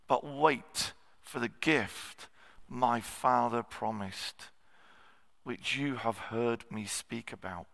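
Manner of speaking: slowing and softening on "but wait for the gift"; scripture reading. Acted. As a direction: read the verse with reverence. Reads the scripture verse.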